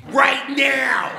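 high, loud joking yell; sudden high shout, hammed up; mock shout. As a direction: bark it out as a playful demand. Demanding bag be filled.

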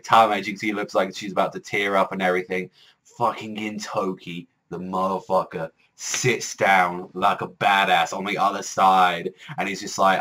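usual speaking voice

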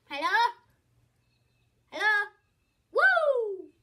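loud, high calling into the phone. Retold or imitated. Girl shouting hello at the spam caller, loud and high. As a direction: call hello down the line loudly.

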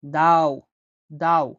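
দাও said with a heavy, deep emphatic d; deep emphatic dad. Shown as a demonstration. Teacher shows দাও to students, heavy deep d. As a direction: drop the d deep into the throat for দাও. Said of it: "গভীরভাবে বলতে হবে".

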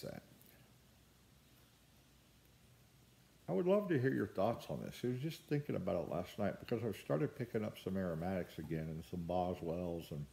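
talking around pipe stem; pipe in mouth. Real, not acted. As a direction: lips clenched on pipe. Seen 4: Pipe stem clenched in mouth while talking, 1.6–14.8 s.

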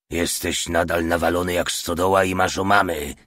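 usual speaking voice

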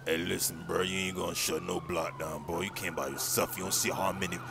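low, soft menacing voice; low threatening gangster; street thug. Acted. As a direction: brush off a lone challenger. Plays a Grove Street gangster.

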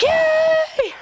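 high-pitched mock cheer; gleeful cheer. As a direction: play yourself delighted, way up high. Acts out her own delight.

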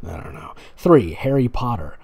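usual speaking voice

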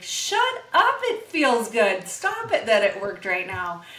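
mock outrage at good news; disbelieving shout. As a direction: burst out amazed it worked. Excited retold reaction to athlete.

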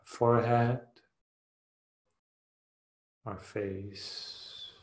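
ordinary delivery

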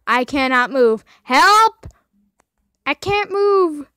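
frantic yelling. Real, not acted. Stuck gamer yells for help. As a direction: yell for help in frustration.

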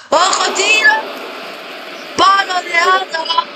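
sudden high cry at the shot; excited commentary. Acted. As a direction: jump up as the ball hits the post. Shot hits the post.

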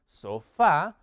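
sofá stressed on the last syllable, so-FÁ; Spanish final stress. Shown as a demonstration. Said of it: "El acento cambia un poquito". (sofá con acento en la última sílaba, so-FÁ)

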